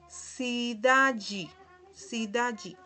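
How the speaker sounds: cidade ending in "ji", "si-DA-ji". Shown as a demonstration. Teacher gives example for learners, cidade said "si-DA-ji". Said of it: "lo pronunciamos G".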